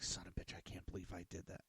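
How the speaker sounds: unvoiced whispered swear; hushed son of a bitch; whispered curse. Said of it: "I was like".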